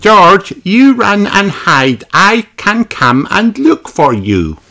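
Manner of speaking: put-on high, bright little girl pig voice; Peppa's voice. A narrator's reading. Peppa telling little brother George to hide, high piggy voice. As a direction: play Peppa the eager big sister. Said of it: "said Peppa".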